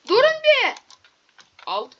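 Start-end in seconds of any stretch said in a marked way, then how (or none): 0.1-0.7 exasperated shout. Real, not acted. Two mobs attacking.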